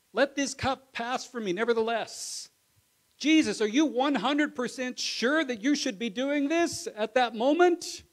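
loud, high pleading preaching; impassioned loud preaching. Acted. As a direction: pour it out at full force. Jesus prayer and question.

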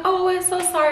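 high, sugary mock sorry; mock apology. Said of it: "they were like".

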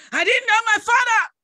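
loud, high confession; forceful, shouted admission; crying out. Retold or imitated. Cries out the admission.

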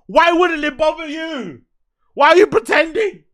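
high-pitched disbelieving questions; incredulous challenge. Real, not acted. Host challenging someone who acts unbothered, incredulous. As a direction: push the pitch right up in disbelief.

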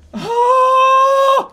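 long, high, sharp intake of breath; big startled gasp; loud gasp. Real, not acted. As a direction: gasp loudly in shock. Sudden shock in game.

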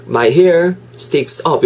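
slow, careful correct sentence; slowly spoken correct English line; slow correct model. Shown as a demonstration. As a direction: read it slowly as the right form. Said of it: "이렇게 간다는 거예요".

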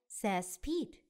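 ordinary delivery

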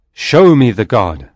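loud demand to the priest; cried demand. A narrator's reading. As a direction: bark the order at the priest. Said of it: "I cried".

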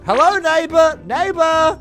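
loud, high neighborly hail; calling out. Acted. Greeting a new neighbor across the road, calling out. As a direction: call across the road to them.

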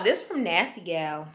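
ordinary delivery

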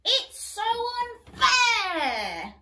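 high, loud whiny protest; stomping princess. Acted. Princess stomping at the governess, high whiny protest. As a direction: stamp your foot and whine it out. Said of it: "stomped the princess".

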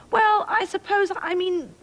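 high, flustered stammer; spluttering. A narrator's reading. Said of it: "spluttered the king".

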